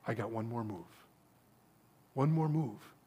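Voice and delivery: very soft, low reply; quiet assurance. Acted. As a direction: answer calmly and with certainty, almost under the voice. God answers Satan calmly.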